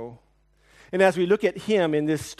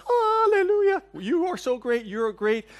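gushing imitated praise from the kids; mock hallelujah cheer; 11 semitones higher than usual. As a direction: cheer him on like a hero, hammed up. Family praising dad, mock cheer.

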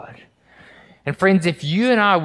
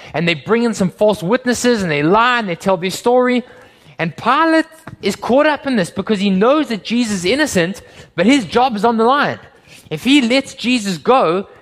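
loud, high, driving sermon delivery; pulpit-pounding storyteller; fiery preaching; 7 semitones higher, 5 dB louder than usual. Acted. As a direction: preach it at full force, building urgency. Retelling the trial at full force.

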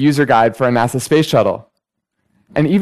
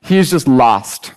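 ordinary talk

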